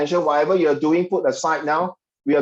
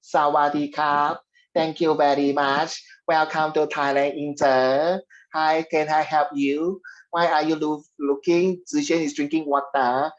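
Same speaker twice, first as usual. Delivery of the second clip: put-on slow, drawn-out Thai-accented English; mock Thai accent. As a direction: play a Thai greeter, stretching every phrase in Thai accent. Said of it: "Of course, Thai you should know".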